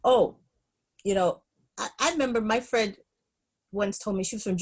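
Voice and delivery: ordinary delivery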